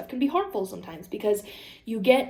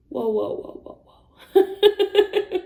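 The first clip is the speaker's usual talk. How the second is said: calming boyfriend imitation; 6 semitones higher, 6 dB louder, much slower than usual. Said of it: "And he's like".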